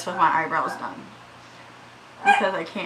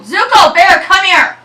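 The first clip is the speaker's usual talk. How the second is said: full-volume high shout; yelling; 12 semitones higher, 18 dB louder than usual. Real, not acted. Owner shouts at dog that won't come, yelling. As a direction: yell a command at the dog. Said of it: "I'm so sorry for yelling".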